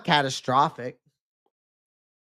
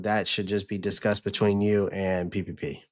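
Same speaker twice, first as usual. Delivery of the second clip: ordinary delivery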